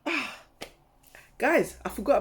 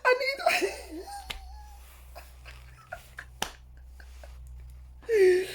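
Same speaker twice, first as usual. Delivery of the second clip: breathless whimper. Retold or imitated. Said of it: "I was like".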